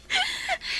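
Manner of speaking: high squealing giggle; a short teasing squeal of a laugh. Acted. Friend giggles at her confession, high squealing laugh.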